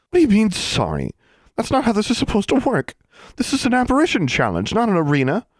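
put-on high, sharp indignant girl; indignant witch. A narrator's reading. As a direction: protest with rising outrage. Narrator voices indignant Rebecca.

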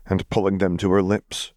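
usual speaking voice

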